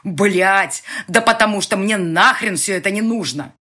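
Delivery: loud angry shout back; furious yell. A narrator's reading. Boss shouts back at the heroine, furious yell. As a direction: roar it back at her. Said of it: "и заорал в ответ".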